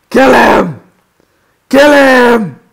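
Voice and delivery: loud, high, drawn-out command; bloodthirsty yell. Acted. Player urging the kill in game, bloodthirsty yell. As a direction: roar it like ordering an execution.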